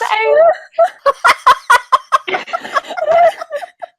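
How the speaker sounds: long, high-pitched laughing fit; helpless laughter. Real, not acted. Storyteller cracking up at own cat joke, helpless laughter. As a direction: burst out laughing, squealing.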